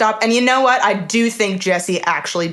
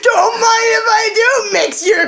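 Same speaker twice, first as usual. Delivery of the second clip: loud mock shout; 9 semitones higher, 4 dB louder, slower than usual. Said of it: "I'm like".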